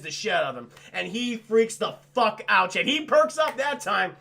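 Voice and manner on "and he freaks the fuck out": ordinary talk, nothing marked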